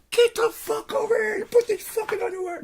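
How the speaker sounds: very high, loud furious wife; full-on angry scream. Acted. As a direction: commit fully to screaming the order. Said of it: "You gotta commit full on".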